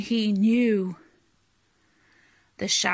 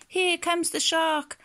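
high storybook alarm; flustered cry; 9 semitones higher than usual. A narrator's reading. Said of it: "all of the fishes were flustered".

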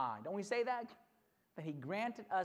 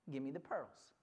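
soft, low fatherly request; gentle dad; 7 semitones lower, 6 dB quieter than usual. Acted. Dad asking daughter for her pearls, soft and low. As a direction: ask quietly, without pressure. Dad softly asks for treasure.

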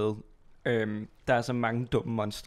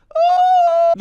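sudden high loud shriek of fright; a real shriek of alarm; startled scream; 12 dB louder than usual. Real, not acted. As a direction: scream as something jumps out. Startled by monster in game.

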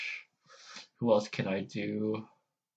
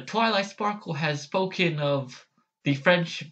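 higher, formal princess voice; Princess Luna; 6 semitones higher than usual. Acted. Fan attempting a princess's proclamation, higher formal voice. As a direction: try a stately, declaiming princess, pitched up. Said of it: "Princess Luna voice here".

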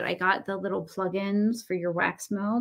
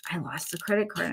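ordinary talk

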